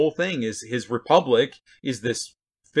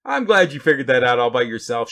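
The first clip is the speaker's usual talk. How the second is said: raised, mock-admiring praise; sarcastic parent; 3 semitones higher, 7 dB louder than usual. Parent to a kid who figured it out, sarcastically. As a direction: gush it with loud false admiration. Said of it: "parents in particular do this with kids".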